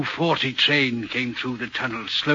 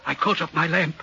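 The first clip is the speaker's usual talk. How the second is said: ordinary talk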